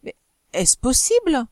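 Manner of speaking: high, rising disbelief; acted astonished questioning; stupefied. A narrator's reading. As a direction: ask it as if you can't believe who came in. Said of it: "il eut la stupeur de voir".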